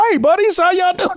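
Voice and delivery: mock friendly greeting. Mock greeting to menacing gang.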